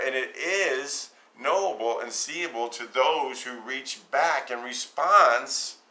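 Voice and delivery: impassioned sermon climax; fervent preaching. Acted. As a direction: press the point with growing intensity. Preaching builds.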